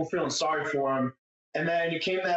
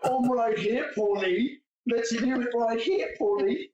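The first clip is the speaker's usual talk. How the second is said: higher, taunting mimicry of Kyle; mocking Kyle; 7 semitones higher, slower than usual. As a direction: call Paulie out in a lightly mocking voice. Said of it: "his dumbass ... be like".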